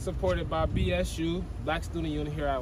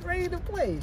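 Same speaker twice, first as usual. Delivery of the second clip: high put-on girl voice; squeaky falsetto girl voice; man doing a woman's voice; 11 semitones higher, 4 dB quieter than usual. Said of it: "we gotta practice our girl voice".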